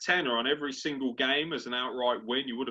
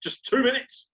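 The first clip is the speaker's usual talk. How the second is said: rising, louder mock outrage at an interruption; higher and higher mock fury; exasperated outburst; 7 semitones higher, 4 dB louder than usual. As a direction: scold him for ruining the moment. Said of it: "so I was like".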